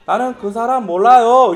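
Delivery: put-on loud rural-accented "I don't know him"; Galilean-accented denial. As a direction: Peter blurting out in a giveaway accent. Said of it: "약간 그런 식으로 들리는 거예요".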